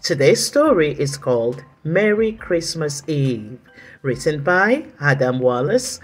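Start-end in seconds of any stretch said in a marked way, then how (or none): none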